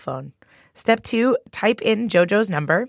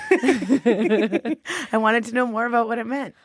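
embarrassed laughing; 3 semitones higher than usual. Real, not acted. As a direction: laugh it off sheepishly.

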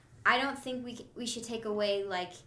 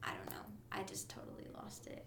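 fading to a soft whisper; trailing off; 13 dB quieter, faster than usual. Real, not acted. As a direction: lose the thought and let it fade. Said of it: "I lost my train of thought".

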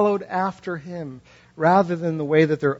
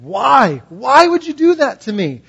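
anguished outcry; 9 semitones higher, 8 dB louder than usual. Acted. Hosea crying out to God.

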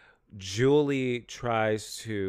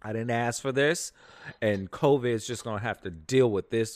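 ordinary delivery

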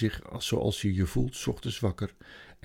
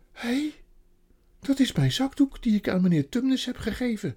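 put-on much higher surprised girl; girl's voice; 13 semitones higher, 4 dB louder than usual. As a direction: exclaim like a young girl. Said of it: "zei Lucie".

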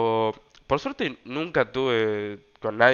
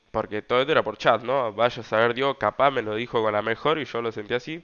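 ordinary delivery

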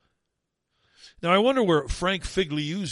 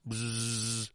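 buzzing like a gnat; 4 semitones lower, 7 dB quieter than usual. Retold or imitated. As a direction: buzz like an annoying bug.

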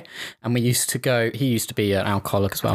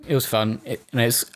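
ordinary delivery

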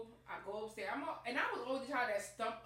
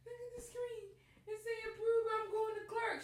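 much higher excited telling; 12 semitones higher than usual. Retold or imitated. Showing mom the approval, high excited voice. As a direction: squeal the news.

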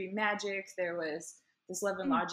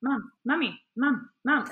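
lightly imitated pestering toddler; repeated calls for attention, each one separate; a hint of a nagging little kid; Stewie from Family Guy; 4 semitones higher, 6 dB louder than usual. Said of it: "he was like Stewie, like, from Family Guy".

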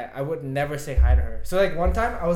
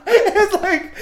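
laughing; 17 dB louder than usual. Real, not acted. Disbelief at coincidence.

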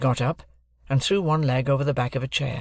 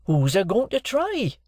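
retorting; 8 semitones higher, slower than usual. A narrator's reading. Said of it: "retorted Joe".

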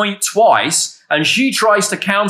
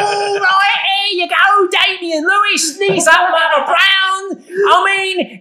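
high, hammed-up Cockney patter; Damian Lewis; 10 semitones higher than usual. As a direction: put on a cartoonish London accent, way up high. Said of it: "That's how he talks".

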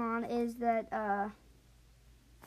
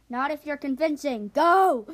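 ordering shout; 2 semitones higher, 9 dB louder than usual. Acted. Mars orders dragonets in.

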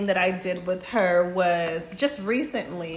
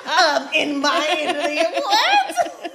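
laughing; 9 semitones higher, 5 dB louder than usual. Real, not acted. Laughing at friend's demand.

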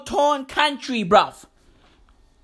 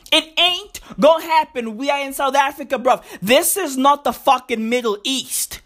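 ordinary talk

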